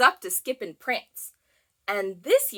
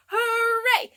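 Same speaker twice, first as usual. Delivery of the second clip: high, loud cheer of hooray; shouted hooray; 11 semitones higher, 3 dB louder than usual. Acted. Teacher cheering hooray with the class. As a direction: cheer it out to the kids. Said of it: "shout hooray".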